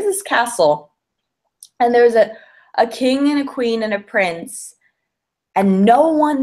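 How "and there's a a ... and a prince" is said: normal talking voice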